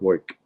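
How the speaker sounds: "woik" cut short, oy vowel for work. Retold or imitated. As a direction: say work as a quick New York "woik". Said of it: "and it's really short".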